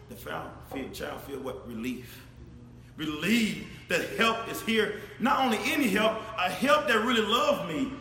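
normal talking voice

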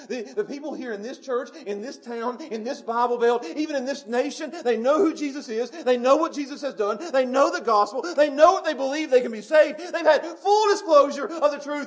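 full-force preaching. Acted. Preacher telling the church they know Jesus, building to a shout. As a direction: ring it out to the back pew.

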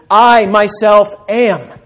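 loud, high and very slow; booming declaration. Shown as a demonstration. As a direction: proclaim each word like God's own name. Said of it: "Greek translation of the Old Testament".

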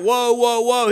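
loud, ringing cry of woe; angel crying out. Acted. Preacher voices the angel crying woe, loud. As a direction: call it out like a herald angel. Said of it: "the angel is crying out".

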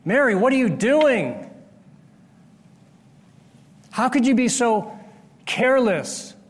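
acted rebuke of Mary's waste; scolding. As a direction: scold her like the outraged disciples. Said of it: "and they scolded her".

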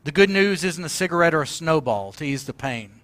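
ordinary talk, nothing marked

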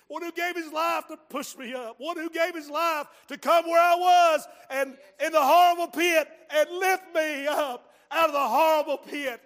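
rising to a loud, slow preacher's cry; building preaching climax. Acted. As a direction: build it to full force.

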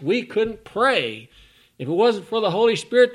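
loud, ringing sermon delivery; full-voiced preacher; fiery preaching. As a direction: preach it out to the back row.

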